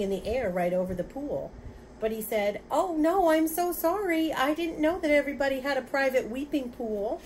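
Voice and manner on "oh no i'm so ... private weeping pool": high, loud, flustered apology; anxious apology. Acted. Father apologizing to the angry voice over the pool, anxious apology. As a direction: apologize hastily from a raised pitch.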